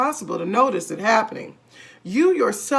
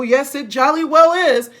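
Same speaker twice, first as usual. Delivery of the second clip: higher, louder taunt; gleeful insistence; 6 semitones higher, 8 dB louder than usual. A narrator's reading. Husband gleefully insisting she shrinks. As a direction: rub it in with glee. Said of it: "said Mister Twit".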